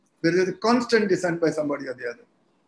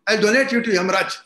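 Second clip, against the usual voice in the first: raised, higher voice of an angered father; storyteller's angry father, pitched up and loud; retold angry outburst; 4 semitones higher, 6 dB louder than usual. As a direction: act out a father losing his temper, louder and higher. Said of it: "He gets angry and says".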